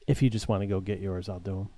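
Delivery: soft, low, contained offer; composed. Retold or imitated. Worker offers to do the bags after her outburst, composed. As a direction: drop pitch and volume, pulled back together. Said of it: "and then composes herself".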